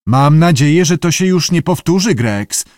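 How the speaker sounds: stern director. A narrator's reading. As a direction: reprimand a subordinate firmly. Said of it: "powiedział surowo sir Charles".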